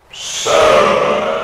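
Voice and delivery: low, drawn-out dying robot; strangled metallic voice. A narrator's reading. The broken machine's last word, strangled and metallic. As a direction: creak out one last word. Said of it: "a strangled metallic voice creaked out".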